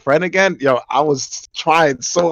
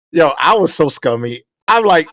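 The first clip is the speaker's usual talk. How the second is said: ordinary talk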